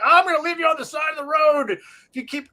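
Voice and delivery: high, loud, scolding parent; mock angry parent threat. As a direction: bark the threat like a fed-up dad. Parent threatening misbehaving kids.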